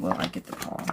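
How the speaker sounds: low unvoiced whisper to the deck; hushed whisper into the cards. Real, not acted. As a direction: breathe the question to the cards. Whispering question over tarot cards.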